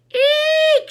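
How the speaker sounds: high squealing eek; shriek. A narrator's reading. Emily knocked off the bleachers, a high shriek. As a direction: squeal in sudden fright. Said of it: "she said".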